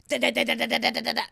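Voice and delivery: rhythmic pounding da da; mock pounding syllables; jackhammer rhythm. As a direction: chop it out like a machine.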